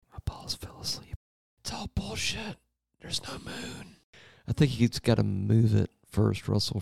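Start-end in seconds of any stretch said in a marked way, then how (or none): 0.1-4.0 hushed, breathy aside; whispering. Real, not acted. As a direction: whisper it so no one hears.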